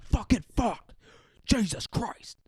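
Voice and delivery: high-pitched, strained cursing rant; furious Putin outburst. Pretend. Putin swearing as the key snaps in the door, high furious yell. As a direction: explode at the broken key, voice shot up high. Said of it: "he's just gonna be like".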